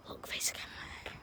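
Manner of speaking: breathy hushed command; whispering. Real, not acted. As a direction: say it without voice. Whispered order while spying.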